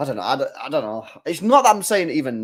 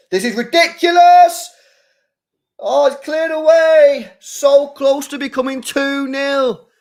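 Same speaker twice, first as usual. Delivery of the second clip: "ridiculous" yelled much higher and louder; disbelieving shout; 12 semitones higher, 7 dB louder than usual. Real, not acted. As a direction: erupt as the ball nearly goes in. Near-goal outburst, fan watching live.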